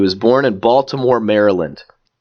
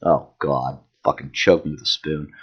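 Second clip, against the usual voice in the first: ordinary talk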